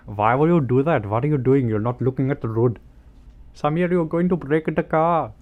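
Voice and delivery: exasperated passenger, playing it big; mock-angry scolding. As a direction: yell at a bad driver in a put-on voice. Acted passenger scolding driver Samir.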